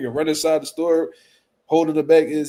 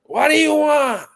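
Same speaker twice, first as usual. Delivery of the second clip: high, loud, slow put-on voice; high impatient order-taker voice; impression of takeout lady; 11 semitones higher, 5 dB louder, slower than usual. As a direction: bark it like the woman on the phone. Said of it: "She be like".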